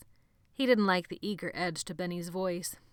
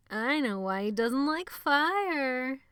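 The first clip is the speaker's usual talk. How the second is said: high, slow, loud sneer; 8 semitones higher, 6 dB louder, much slower than usual. A narrator's reading. Benny gloating to the room, slow sneer. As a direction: savor the secret with a sneer. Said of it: "smile ripening into a sickening sneer".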